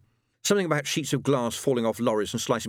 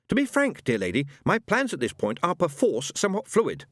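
high, prissy English gentleman; a mild, polite angel speaking; fussy gentleman's voice; 9 semitones higher than usual. A narrator's reading. Aziraphale explaining himself to Tracy, prim gentleman.

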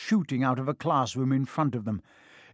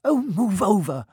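higher-pitched impatient snarl; 7 semitones higher, 4 dB louder than usual. A narrator's reading. Hermione takes over at the locked door, snarling. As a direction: push in with an exasperated order. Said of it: "Hermione snarled".

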